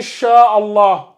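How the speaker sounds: "Inch'Allah" very slowly, n hidden in a long nasal hum; drawn-out recited "Inch'Allah", light nasal hum for n; dissimulation with nasalization. Shown as a demonstration. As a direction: stretch the hidden n of "Inch'Allah" through the nose.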